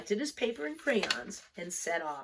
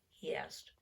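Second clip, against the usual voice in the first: usual speaking voice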